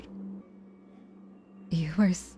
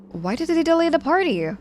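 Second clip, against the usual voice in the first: much higher and louder, pressing the question; upset disbelief; 11 semitones higher, 8 dB louder than usual. Acted. Patient upset that friends delayed the party, high incredulous demand.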